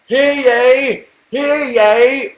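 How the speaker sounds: loud, high proclamation; town crier. Retold or imitated. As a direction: imitate a town crier calling out. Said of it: "when that town crier came out".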